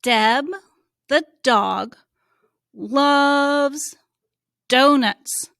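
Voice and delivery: Deb, the dog, loves donuts said loud and slow, word apart; booming read-along; loud choral reading. Shown as a demonstration. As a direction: read along loudly for the kids. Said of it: "You read it with me this time".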